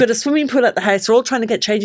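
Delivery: usual speaking voice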